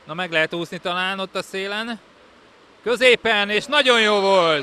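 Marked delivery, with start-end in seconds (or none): 2.8-4.6 loud, slow goal praise; excited sports commentary. Real, not acted. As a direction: cheer the great move. Goal called in live commentary. (izgatott, lelkes kommentár)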